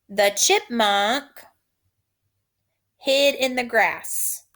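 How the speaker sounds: "The chipmunk hid in the grass." slow, phrase by phrase; slow dictation. Shown as a demonstration. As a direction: dictate it slowly in chunks. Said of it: "Repeat after me".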